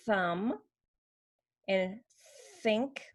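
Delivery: drawn-out airy TH starting "thumb" and "think"; voiceless TH. Shown as a demonstration. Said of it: "Sometimes the voice is off".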